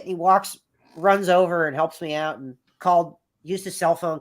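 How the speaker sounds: usual speaking voice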